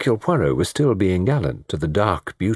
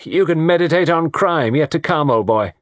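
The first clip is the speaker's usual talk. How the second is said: higher, breezy parting jibe; called over shoulder; 9 semitones higher, 4 dB louder than usual. Acted. Tony calling back to the detective while dancing off, breezy. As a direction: toss it back airily, raised voice. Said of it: "remarked over his shoulder".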